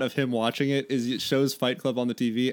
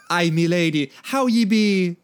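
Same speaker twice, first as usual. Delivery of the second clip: louder, higher hammed-up Celtic greeting; Celtic accent; 3 semitones higher, 5 dB louder than usual. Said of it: "donning his best Celtic accent".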